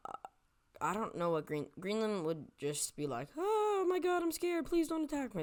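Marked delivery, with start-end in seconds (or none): 3.4-5.4 high-pitched panicky pleading voice; put-on scaredy-cat squeal; mock frightened.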